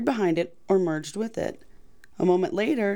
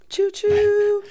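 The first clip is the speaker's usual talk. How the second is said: high, loud choo choo; train whistle imitation; 13 semitones higher, 6 dB louder than usual. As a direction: toot like a toy train. Train noise joke.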